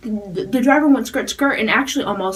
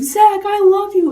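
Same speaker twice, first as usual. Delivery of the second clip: much higher and louder, slow drawn-out scream; fangirl scream; 12 semitones higher, 7 dB louder, slower than usual. Retold or imitated. Fan screaming at the singer, much higher and louder. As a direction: scream it up high at the band. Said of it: "Zach probably heard me being like".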